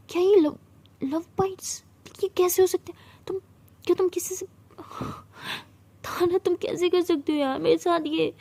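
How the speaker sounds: high, halting and distraught; breaking into tears. Acted. Siya realises Tara has been bitten, tearfully accusing. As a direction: stumble over the words toward crying. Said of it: "पागलों की तरह रोने लगती है".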